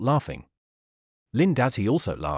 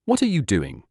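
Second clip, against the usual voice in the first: a touch of irritation in the question; mildly unhappy. A narrator's reading. Girlfriend put out by boyfriend, mildly unhappy. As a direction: let a little displeasure show. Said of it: "Zhong Xiaoli asked unhappily".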